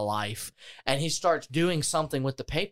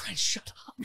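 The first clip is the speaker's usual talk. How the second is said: whispered warning; 5 semitones higher, 8 dB quieter than usual. Retold or imitated. Hissing at friend to stop.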